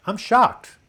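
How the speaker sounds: high, loud punchline exclamation; hammed-up electric shock; mock shock. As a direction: cry it out as the joke's punchline. Acting out a joke punchline.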